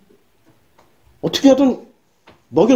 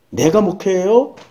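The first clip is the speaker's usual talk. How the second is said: ordinary talk, nothing marked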